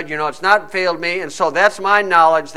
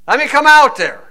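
higher and louder, keen to jump in; eager plea; 7 semitones higher, 5 dB louder than usual. Acted. Peter calling out to the Lord on the water, eager plea. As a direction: Peter itching to step out of the boat.

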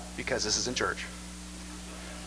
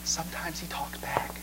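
whisper; 10 dB quieter than usual. Real, not acted. Preacher drops to a whisper.

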